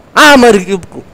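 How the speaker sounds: loud high outburst; frustrated shout. Real, not acted. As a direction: yell it out in frustration. Gamer yelling at game.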